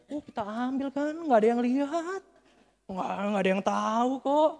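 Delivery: high, loud singsong scheming; mock tempting. Preacher acting out keeping money nobody saw, mock tempting. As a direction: stretch it out like a hammed-up tempter.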